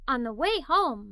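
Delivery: ordinary delivery, nothing marked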